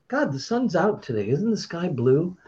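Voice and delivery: feigned breeziness. Pretend. Host skirting gossip about a former colleague, feigned breeziness. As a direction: dodge the topic with airy small talk. Pointedly changing the subject from a person.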